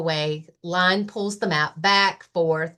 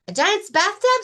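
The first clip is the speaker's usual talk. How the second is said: very high, loud eager bunny voice; excited Rabbit; 12 semitones higher, 5 dB louder than usual. Acted. Rabbit offering a picnic to Lion, very high and loud. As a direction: squeal it out happily.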